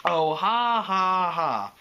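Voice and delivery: loud, fake sarcastic laugh; withering. A narrator's reading. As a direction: spell out each ha, falling away unamused. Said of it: "he said witheredly".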